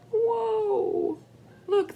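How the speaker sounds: high squealed whoa of delight; bright wondrous whoa; excited whoa. Real, not acted. Grandma reacting to picture.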